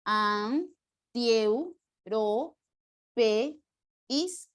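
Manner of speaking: drawn out syllable by syllable, antieuropeístas as an-tieu-ro-pe-ís-tas; syllabify antieuropeístas slowly; slow syllable split. Shown as a demonstration. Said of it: "En la palabra antieuropeísta".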